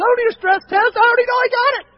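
high, loud, wound-up insisting; mock stressed protest. Patient telling his doctor he needs no stress test, high frantic protest. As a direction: play it frantic and tense. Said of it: "I told the doctor, I said".

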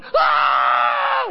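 scared yell. Retold or imitated. Scared on the ride.

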